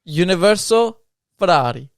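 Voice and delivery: Universal Ferrari said in a hammed-up Italian accent, rolled and sing-song. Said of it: "Say it with the Italian accent".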